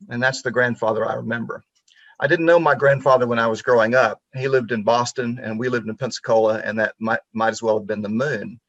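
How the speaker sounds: usual speaking voice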